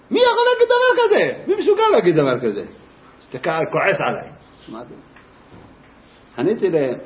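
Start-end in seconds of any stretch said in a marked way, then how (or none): 0.1-2.6 shocked parent. Retold or imitated. Angry parent quoted.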